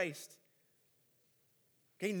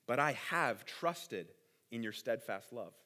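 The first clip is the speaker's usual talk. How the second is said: slow, much softer, lower, tender; quiet trusting; 8 semitones lower, 5 dB quieter, slower than usual. Retold or imitated. Preacher reading David's psalm of trust, slow and hushed. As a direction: let the trust settle, unhurried and soft.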